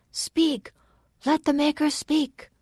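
high-pitched calls, little added volume; high, restrained roar of assent; crowd's roar. A narrator's reading. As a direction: urge the speaker on as a crowd would. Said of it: "the crowd roared".

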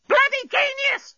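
piercingly high, slow bird screech; parrot scream. A narrator's reading. Parrot repeating itself to Bolfas, high scream. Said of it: "screamed the parrot".

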